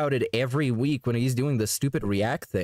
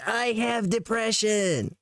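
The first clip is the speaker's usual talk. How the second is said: slow, raised, put-on sad voice; mock sad whine; 6 semitones higher, slower than usual. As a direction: drag out a fake sob story. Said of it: "And then he's like".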